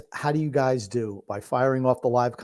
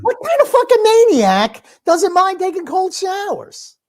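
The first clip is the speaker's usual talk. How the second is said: much higher, louder disbelieving rant; incredulous outburst; 7 dB louder than usual. Real, not acted. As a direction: blurt it out in loud disbelief. Mock outrage at cold showers.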